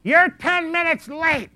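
high, loud, slow mock yell of an old woman; cranky old lady yell. Myrtle scolding the late pastor, mock old-lady yell. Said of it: "Myrtle yell at me".

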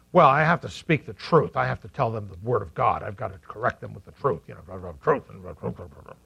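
sinking low and soft into blah blah; mock the preachy truth-teller until it fades out. Preacher mocks Christians who lecture with the truth.

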